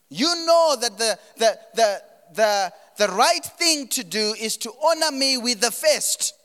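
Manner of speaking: high, loud, urgent preaching; preaching at full force. Acted. Preacher rebuking the church for giving God scraps, loud and high. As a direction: lift it and push it out to the church.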